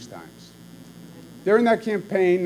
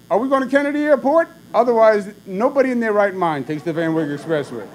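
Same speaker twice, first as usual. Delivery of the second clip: much higher and louder incredulous rant, fast at first; imitating a furious outburst; 8 semitones higher, 8 dB louder than usual. Father berating driver son about the Van Wyck, mock furious. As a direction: scoff loudly at the route choice. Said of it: "would really lose his temper".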